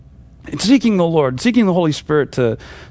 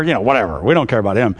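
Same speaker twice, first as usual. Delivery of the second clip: dismissive father; 8 semitones lower, faster than usual. Retold or imitated. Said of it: "He just says".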